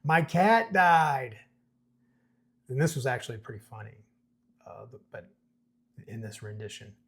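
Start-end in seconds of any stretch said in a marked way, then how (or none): 0.0-1.4 slow, higher, louder read-aloud yell. A narrator's reading. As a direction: stretch each word out in a raised voice. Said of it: "Bear still yelling".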